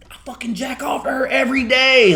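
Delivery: high, loud hammed-up fan yell; mock fan shout. Quoting a fan at the Derby, loud mock shout. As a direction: bellow it like a crude fan. Fans quoted loudly at the Derby.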